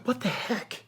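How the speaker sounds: high, hushed astonishment; soft, high-pitched amazement; blown away. Retold or imitated. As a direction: retell stunned disbelief under your breath. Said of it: "I was blown away".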